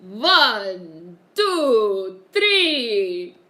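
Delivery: loud, drawn-out Sesame Street vampire counting. Acted. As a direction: count out each number like a puppet vampire. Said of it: "Oh, like the Count?".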